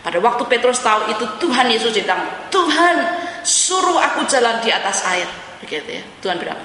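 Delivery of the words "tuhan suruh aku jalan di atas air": high, eager call to the Lord; eager Peter. Retold or imitated. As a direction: Peter calling out, thrilled to try. Peter asking Jesus eagerly.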